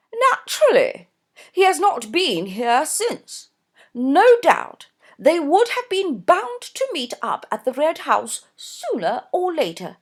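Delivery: high, loud gushing society mother; gushing Mrs Norbury. A narrator's reading. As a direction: voice her high and effusive, a fussy mother. Narrator voices Mrs Norbury.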